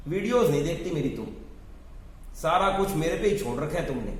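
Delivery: normal talking voice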